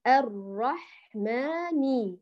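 الرحمن very slowly, syllable by syllable; slow spelling. Shown as a demonstration. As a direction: read الرحمن out slowly piece by piece. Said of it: "بهذا الشكل".

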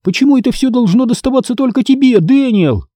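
high-pitched aggrieved protest; indignant child's complaint; arguing. A narrator's reading. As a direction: argue with your brother over the unfairness. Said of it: "заспорила я".

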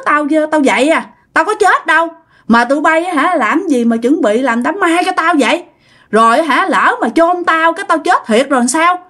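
put-on high-pitched indignant old father telling everyone off; scolding. Revived father berates family for preparing his funeral, scolding. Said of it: "Ông la, ông nạt mọi người".

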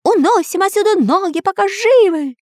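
very high frightened wail; high cartoon terror; panicked moan. A narrator's reading. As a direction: urge escape in a squealing panic. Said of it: "простонал Дырка".

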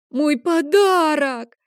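put-on boy starting to cry for his lost present, high, loud, drawn out; crying wail. A narrator's reading. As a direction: burst into tears over it. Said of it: "Лео начал плакать".

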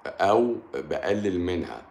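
ordinary talk, nothing marked

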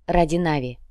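plain reading voice

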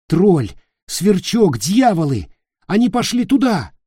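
high, loud, urgent alarm; urgent shouting. Acted. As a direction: blurt it out in a rush. Nick urgently shakes Peter.

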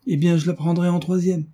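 high, annoyed retort; curt and dry. A narrator's reading. Antoinette irritated at the girls' astonishment, curt and dry. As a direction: answer back curtly in a high pitch. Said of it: "dit sèchement Antoinette".

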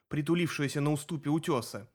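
ordinary delivery

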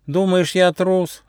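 high-pitched hurt question; offended. A narrator's reading. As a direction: protest like a slighted little mouse. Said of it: "обиделся мышонок".

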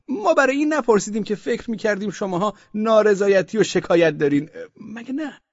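high, loud official voice, hurriedly explaining; a bureaucrat covering his tracks; flustered official. Acted. Osman Bey backpedals to the merchants in a high, flustered official voice.

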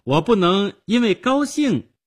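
put-on high, loud, indignant girl; biting, challenging girlish retort; cutting. A narrator's reading. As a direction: throw the questions back tartly. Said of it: "他尖刻地说".

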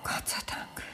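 very soft, breathy whisper of relief; relieved whisper. Acted. Wife hearing the police are coming, in a relieved whisper. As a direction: let it out as a faint breath.

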